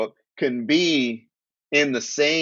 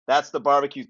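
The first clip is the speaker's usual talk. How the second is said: usual speaking voice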